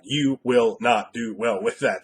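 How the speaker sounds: slow, each word of "You will not do well" set apart; emphatic repeat. Real, not acted. Game master warning the unprepared, every word slow and separate. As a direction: spell out "will not" slowly and firmly.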